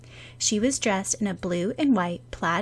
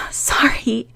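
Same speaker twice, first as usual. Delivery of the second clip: tiny whispered apology; small squeak; 5 semitones higher than usual. Acted. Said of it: "she squeaked in a small voice".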